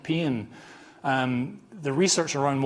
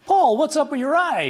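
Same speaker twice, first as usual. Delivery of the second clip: high, loud, put-on San Diego accent; hammed-up San Diego accent, pitched way up; imitating a San Diego accent, by his own account badly; 13 semitones higher, 7 dB louder than usual. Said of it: "a San Diego accent".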